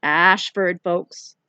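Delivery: loud announcement down the bus; calling out. Acted. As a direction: call the stop out to the whole bus. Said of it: "the driver called out".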